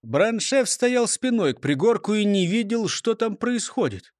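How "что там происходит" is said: ordinary delivery, nothing marked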